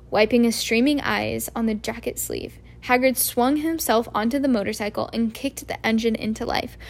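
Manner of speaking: plain reading voice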